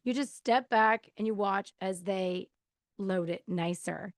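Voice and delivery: ordinary talk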